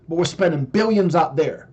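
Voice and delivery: normal talking voice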